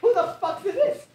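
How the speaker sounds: high loud alarmed yell; startled shout. Acted. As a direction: shout in shock.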